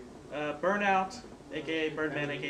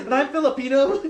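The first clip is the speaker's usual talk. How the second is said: laughing through it, louder and higher; 7 semitones higher, 11 dB louder than usual. Real, not acted. Telling friends a funny story, laughing loudly. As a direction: blurt it out mid-laugh.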